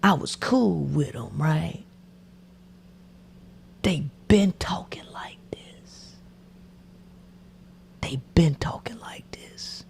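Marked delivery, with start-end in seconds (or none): 3.9-9.8 whisper. Real, not acted. Confiding whisper, repeated.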